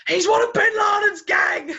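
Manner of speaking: high, loud outraged yell; hammed-up accusation shouted at a dog. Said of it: "where he's trying to train his dog".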